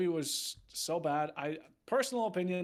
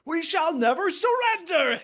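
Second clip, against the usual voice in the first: high, very loud defiant war cry; full-throated, hammed-up oratory; screamed Churchill speech; 11 semitones higher, 8 dB louder than usual. Shown as a demonstration. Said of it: "It's just fucking screaming it out".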